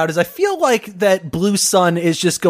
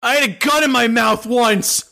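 higher-pitched, lightly voiced candidate answer; debate candidate; 5 semitones higher than usual.

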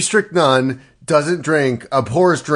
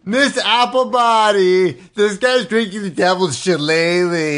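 hammed-up ghost-kid snitch; tattling kid; 4 semitones higher than usual. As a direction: sing out like a child telling on someone. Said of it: "Who do they tell?".

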